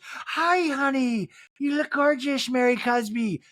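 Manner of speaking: high, fawning socialite imitation; over-the-top high gush; gushing greeting. Meredith fawning over Mary.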